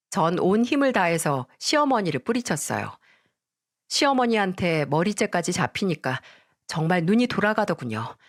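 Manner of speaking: ordinary delivery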